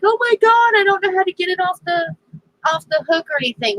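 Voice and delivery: high, fast imitation of a panicky man; mock panic. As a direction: blurt it out in pretend alarm, rushing. Retells brother afraid of fish.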